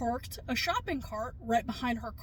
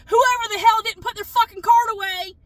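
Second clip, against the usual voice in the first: real fury, much louder and higher; angry outburst; 8 semitones higher, 13 dB louder than usual. Real, not acted. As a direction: spit it out, furious at careless people. Venting about the scratched car.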